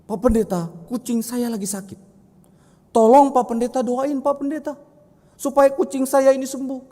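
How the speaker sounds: put-on a little kid pushing the pastor to pray for the cat; begging child.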